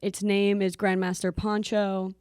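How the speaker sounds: normal talking voice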